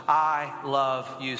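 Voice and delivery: very slow, low-pitched phrase for repeating; deliberate, low call for echo; leading a recitation. Shown as a demonstration. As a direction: drop the pitch and space out each word. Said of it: "Say that with me".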